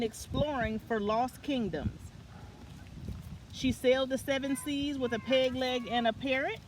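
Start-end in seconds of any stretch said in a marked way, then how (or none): none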